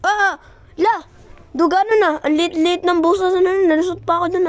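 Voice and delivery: high, loud amazed rush; excited loud exclaiming. Real, not acted. Gamer amazed to squeeze through a tiny gap, loud. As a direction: exclaim it amazed you made it.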